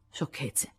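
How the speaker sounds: Jo sussurra secca; ribattuto. A narrator's reading. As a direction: taglia corto sottovoce. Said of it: "ribatté Joe". (un sibilo sprezzante)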